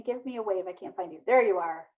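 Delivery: ordinary talk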